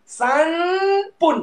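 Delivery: sanpun said slowly with a clear falling end; teacher models sanpun, sanpun drawn out and falling; model pronunciation. Shown as a demonstration. Teacher modeling a counting word, slow and falling. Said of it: "Ah, dia macam tu".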